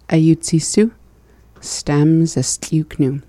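normal talking voice

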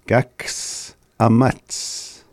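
each syllable drawn out slowly; careful slow phrase read; slow teaching demo. Shown as a demonstration. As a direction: say it very slowly so the sounds come out. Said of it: "I'd like to share our phrase of the day".

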